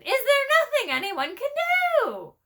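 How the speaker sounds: very high, loud desperate bird voice; pleading high raven. Acted. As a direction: beg for help, squawking high.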